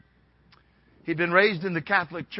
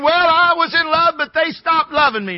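loud, high mock complaint; protesting objector; 9 semitones higher, 8 dB louder than usual. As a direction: imitate someone protesting, pitched well up. Mimics an objector he then rebuts.

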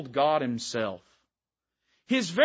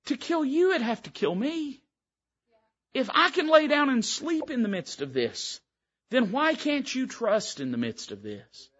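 gentle Master; 8 semitones higher than usual. Acted. Jesus gently answering disciples.

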